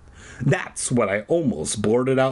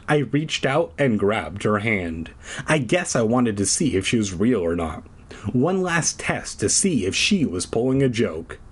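ordinary talk, nothing marked

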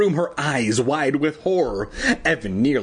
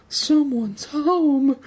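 whispered; 14 semitones higher, much slower than usual. A narrator's reading. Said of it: "Evan whispered".

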